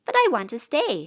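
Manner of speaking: put-on a kid protesting being sent off. A narrator's reading. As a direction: whine to stay. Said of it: "he protested".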